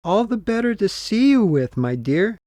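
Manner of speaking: high, sugary fake granny voice; wolf sweetly covering his disguise; wolf as grandmother. Disguised wolf answers the girl from bed, high sugary granny voice. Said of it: "answered the wolf".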